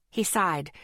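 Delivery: usual speaking voice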